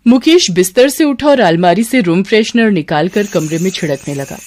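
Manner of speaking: plain reading voice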